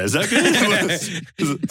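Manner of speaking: very slow, high, laughing; drawn-out giggling question. Real, not acted. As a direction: crack up while repeating the joke. Repeating cohost's joke laughing.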